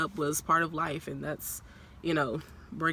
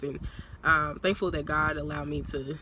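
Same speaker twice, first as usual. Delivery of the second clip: ordinary talk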